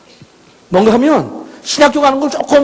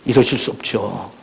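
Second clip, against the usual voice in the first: retold weeping plea; 12 semitones lower, 8 dB quieter than usual. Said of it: "통곡하기 시작했어요".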